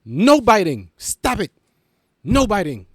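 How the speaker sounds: yelling at a stray dog to stop biting; scolding a dog. Retold or imitated. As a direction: shout down a biting pit bull. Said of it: "like an actual stray animal".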